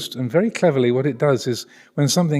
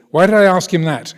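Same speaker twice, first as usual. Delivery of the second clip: higher and louder quizzing, in posh military English; posh English accent; 7 semitones higher, 9 dB louder than usual. Retold or imitated. Consultant grilling junior doctors, in posh English accent. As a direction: put the trainees on the spot in posh English. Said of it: "I'm not exaggerating his accent".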